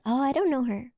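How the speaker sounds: ordinary delivery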